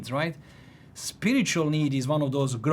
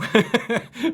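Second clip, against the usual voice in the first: high burst of laughter; 9 semitones higher, 5 dB louder than usual. Real, not acted. Laughing at his own motive.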